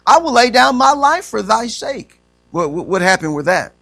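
ordinary talk, nothing marked

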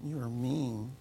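muttered retort. Retold or imitated. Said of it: "I didn't say that".

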